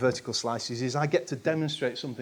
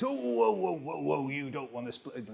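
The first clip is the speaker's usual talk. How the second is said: a hammed-up West Country yokel warning; West Country accent; 5 dB quieter than usual. Mock West Country warning to a team splitting stories. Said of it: "in a West Country accent".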